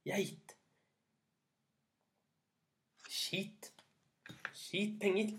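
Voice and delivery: high, drawn-out spell to the goat; magic command. Acted. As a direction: sing out the charm to the goat.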